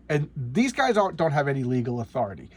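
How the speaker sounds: ordinary delivery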